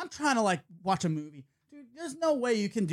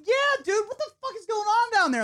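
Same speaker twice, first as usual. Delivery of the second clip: calling up high; 13 semitones higher than usual. Retold or imitated. Calling up to the balcony.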